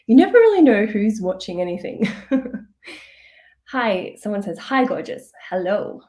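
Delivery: usual speaking voice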